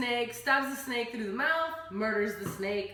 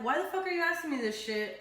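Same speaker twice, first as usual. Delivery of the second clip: higher and fast, irritated; annoyed outburst; 7 semitones higher, faster than usual. Retold or imitated. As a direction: fire it back fast, fed up. Tom snapping at Harry.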